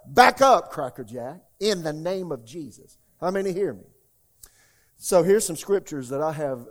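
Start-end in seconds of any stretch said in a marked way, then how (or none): 0.1-3.0 commanding rebuke. Pretend. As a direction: shout the devil down. Rebuking the devil.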